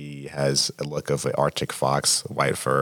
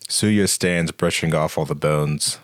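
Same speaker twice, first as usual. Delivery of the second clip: ordinary talk, nothing marked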